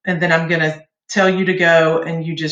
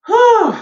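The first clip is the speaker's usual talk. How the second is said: relieved whew; 7 dB louder than usual. Real, not acted. After a long sixteen-beat hiss.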